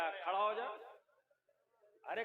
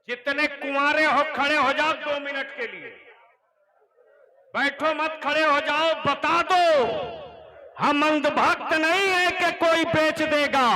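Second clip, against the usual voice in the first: loud, higher rallying cry; rallying call; 8 semitones higher, 13 dB louder than usual. Real, not acted. Cleric calling the unmarried men to stand, rallying shout. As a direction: rouse them to their feet.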